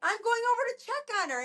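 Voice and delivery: high, fast, incredulous retort; outraged that anyone would leave her there; retold indignant disbelief. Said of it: "I said".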